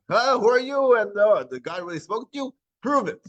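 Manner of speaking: imitating a crowd demanding proof; mocking challenge. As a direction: throw down a skeptical dare.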